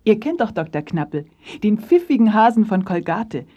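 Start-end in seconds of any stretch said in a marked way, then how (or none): none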